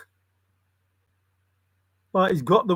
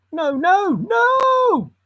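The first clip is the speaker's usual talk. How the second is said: shocked shout; 13 semitones higher, 5 dB louder, much slower than usual. Real, not acted. Shocked protest at the game.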